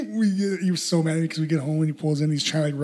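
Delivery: ordinary talk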